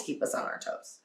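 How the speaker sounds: half-whispered aside. Real, not acted. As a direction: lean in and breathe it. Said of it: "sudden whisper aside".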